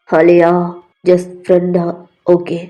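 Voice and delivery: low, firm rejection; restrained anger. Acted. V rejecting Alia's love, restrained low anger. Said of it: "நீ இப்படி கோபமா பேசுறா".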